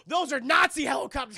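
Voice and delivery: loud, high alarmed yell; mock alarmed shout. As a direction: shout it like a panicked warning.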